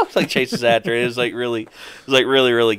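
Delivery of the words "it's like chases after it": normal talking voice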